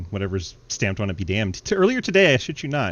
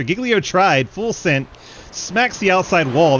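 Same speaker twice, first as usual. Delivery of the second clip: louder and higher, excited; excited finish call; 6 semitones higher, 4 dB louder than usual. Acted. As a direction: build the hype at the finish line. Last-lap finish call.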